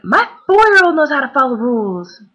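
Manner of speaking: mock yell of an indignant mother; retold yell. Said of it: "then she yelled again".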